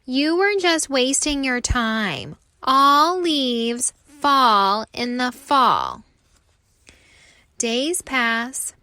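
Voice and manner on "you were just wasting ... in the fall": louder, slow gloating chant; taunting. Acted. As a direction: gloat over his failure. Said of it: "taunt the other leaves".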